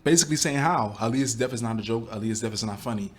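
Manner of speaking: ordinary talk, nothing marked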